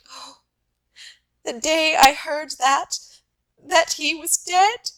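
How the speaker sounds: breaking into sobs. A narrator's reading. Wife telling husband of her sweetheart's death, sobbing. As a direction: let the grief burst out and choke the words. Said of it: "choking with sobs".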